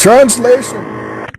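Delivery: loud, slow mock-grand "Translation"; loud slow announcement. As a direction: boom the word out, dragging it.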